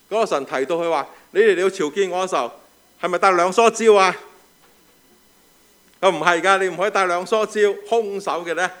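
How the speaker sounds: loud, high, emphatic preaching. Acted. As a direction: preach it out to the congregation. Preaching the sermon at full force.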